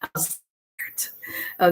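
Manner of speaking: slow, breathy Bronx-style tired line; unvoiced, drawn-out Bronx sigh of a line; Bronx accent. Shown as a demonstration. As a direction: breathe it out slow like a worn-out New Yorker. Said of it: "from the Bronx".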